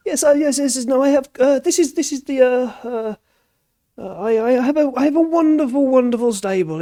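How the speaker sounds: high, eager posh farmer voice; strange farmer accent. Acted. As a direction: proudly offer the stable. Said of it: "I've got a really strange accent now".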